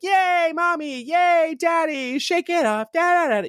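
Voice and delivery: put-on high, sing-song kid cheering; excited little kids. Kids cheering their parents at a pop concert, squealing child voice. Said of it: "the kids were like".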